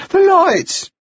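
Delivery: put-on high-pitched breathless boy; gasped out. A narrator's reading. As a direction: gasp it out, winded, in a high boy's voice. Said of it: "he gasped".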